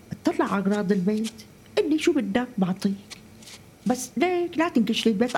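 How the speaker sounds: ordinary talk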